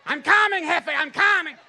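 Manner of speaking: high, loud shouting digger; shouted call to the boss. Retold or imitated. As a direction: yell up to Jefe that you're coming. El Chapo's digger calling out.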